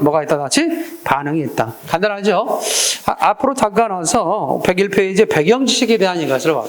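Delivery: normal talking voice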